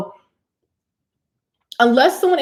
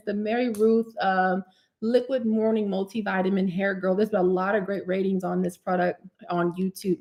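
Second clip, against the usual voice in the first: ordinary talk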